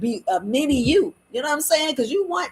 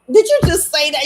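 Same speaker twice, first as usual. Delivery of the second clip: loud, higher shocked question; mock disbelief; 5 semitones higher, 8 dB louder than usual. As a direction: blurt it out in acted shock. Acts out reaction to her words.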